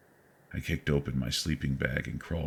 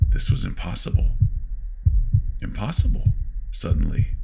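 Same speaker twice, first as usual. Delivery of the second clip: panicked; 2 semitones higher, 8 dB louder than usual. A narrator's reading. Narrator panics.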